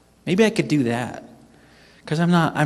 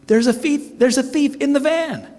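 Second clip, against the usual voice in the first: high-pitched mock alarm; alarmed realisation; 12 semitones higher than usual. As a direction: act out the panic of it dawning on you. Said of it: "and then it dawns on me".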